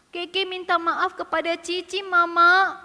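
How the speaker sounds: slow, slightly whiny answer; contrite child. Acted. Keke answering Mama after confessing, whiny contrition. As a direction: answer like a scolded little one.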